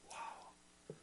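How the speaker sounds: a hushed, breathless whisper of wonder; awed whisper. Real, not acted. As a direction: breathe it out in quiet awe. Awed pause in the sermon.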